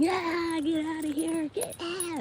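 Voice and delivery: high-pitched mock yell at a raccoon; retold screaming neighbor. Neighbor yelling at a raccoon, mock high yell. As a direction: imitate the neighbor's shrill shout, not full volume. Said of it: "screaming and yelling".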